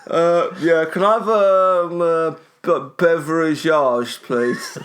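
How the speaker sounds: put-on high, loud fancy-accent ordering, breaking into laughter; hesitant, hammed-up fancy accent. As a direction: attempt a fancy accent and stumble over the word, amused. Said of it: "Good luck saying it with your future accent".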